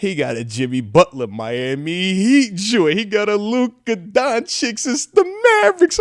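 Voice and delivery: normal talking voice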